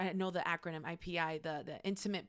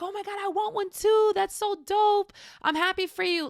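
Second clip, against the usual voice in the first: high, loud gushing; genuine excitement; 12 semitones higher, 7 dB louder than usual. Acted. Happy friend admiring a new car, excited. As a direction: squeal with delight for a friend. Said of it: "Just show genuine excitement".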